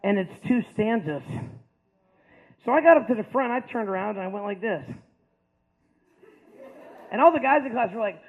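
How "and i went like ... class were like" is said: ordinary talk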